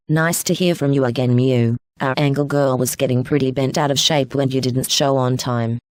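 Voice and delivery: low-pitched, easygoing friend; Venusa, laid-back mate. Acted. Another character, Venusa, speaks.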